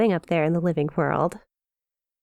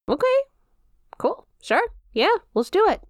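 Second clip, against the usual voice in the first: eager; 11 semitones higher than usual. Acted. Demon eagerly agreeing.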